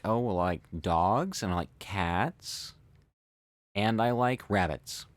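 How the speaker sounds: a rise on "dogs" and "cats", then a fall on "rabbits"; listing intonation. Shown as a demonstration. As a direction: go up on each item of the list and come down on "rabbits". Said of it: "she was going up on every part of the list".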